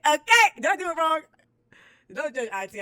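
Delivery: high, loud hype; hyped shout. Real, not acted. Hyping herself up, a high loud cheer.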